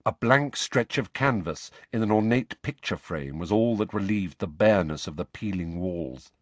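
ordinary delivery, nothing marked

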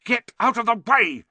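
loud, high angry snap; exasperated shouted order; snapped. A narrator's reading. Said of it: "snapped Ron".

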